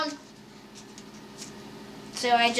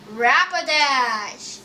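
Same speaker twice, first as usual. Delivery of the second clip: Rapidash stretched out slowly; long, falling Rapidash; drawn-out announcement; slower than usual. Real, not acted. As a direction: draw the name out like a slow announcement. Announces card name with a flourish.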